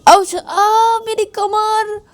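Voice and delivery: very high, exaggerated ouch of pretended hurt; fake pain cry. Ananya falls off on purpose and fakes pain to Payal. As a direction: ham up a fake back injury. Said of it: "जानबूझ के bed से गिर जाती है".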